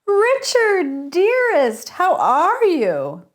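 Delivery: honeyed, over-the-top mock delight toward a rival; fake sweet greeting. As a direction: gush with a forced stage smile. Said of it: "plastered on my best stage smile".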